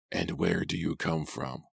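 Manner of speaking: put-on an old man sizing up a stranger; suspicious old cabby.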